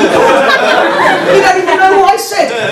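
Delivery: very high, drawn-out protest; exasperated retort. Retold or imitated. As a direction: wail the comeback slowly, mock-aggrieved. Mock argument going in circles.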